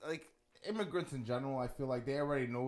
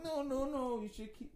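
higher cooing women; 12 semitones higher, 5 dB quieter than usual. Retold or imitated. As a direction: imitate women gushing, higher. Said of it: "they're all like".

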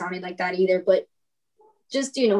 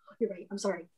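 much softer, chastened mumbled apology; sheepish apology; 11 dB quieter, faster than usual. Retold or imitated. Coworkers apologising after being told off, sheepish and hushed. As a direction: back down quietly, as imitated coworkers.

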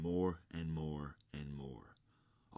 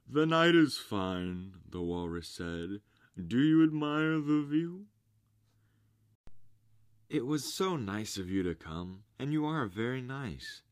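higher, loud, falsely charming; smooth walrus; 7 semitones higher, 8 dB louder than usual. A narrator's reading. Walrus talks to oysters.

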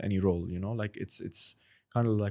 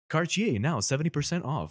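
higher, bright promo announcer; 6 semitones higher than usual. Shown as a demonstration. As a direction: pitch the sale like a TV ad. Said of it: "impression that I like to do".

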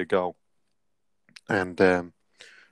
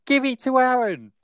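high, mocking coach's sideline call; mock coach shout. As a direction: imitate the dad-coach yelling for his son, pitched up. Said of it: "touchline shouts".

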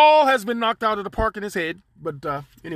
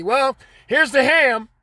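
slow, higher, drawn-out offer; Stephanie's voice; 5 semitones higher, 3 dB louder, slower than usual. Retold or imitated. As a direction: hand it over slowly, higher up. Stephanie voiced handing ham.

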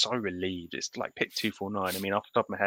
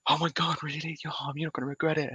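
higher, hammed-up fake delight; mock staged excitement; 8 semitones higher than usual. Mocking a draftee faking surprise for the GM, higher and hammy. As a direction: overplay the surprise like a staged phone call. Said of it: "putting on this".

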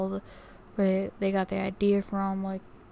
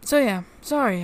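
hiccups; 3 semitones higher, 5 dB louder than usual. Real, not acted. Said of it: "I'm hiccuping now".

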